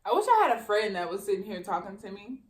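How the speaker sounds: talking while eating; words round a bite of taco; mouth full. Real, not acted. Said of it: "I'm getting full though".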